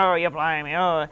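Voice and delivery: hammed-up cartoon pirate; jokey pirate voice. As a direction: play a silly stock pirate. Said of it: "we do the jokey pirate voice".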